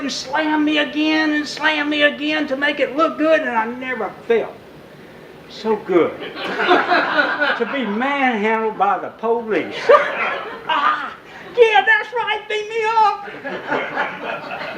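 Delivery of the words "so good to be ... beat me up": high, laughing through the words; recalling the rough arrest, laughing. Real, not acted. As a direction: laugh while telling it. Laughing while telling.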